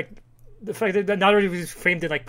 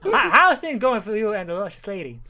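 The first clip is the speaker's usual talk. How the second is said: hammed-up Southern accent, louder; badly faked Southern accent, pitched up; 5 semitones higher, 6 dB louder than usual. Gulch asks about the lady, in mock Southern accent. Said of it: "do a Southern accent".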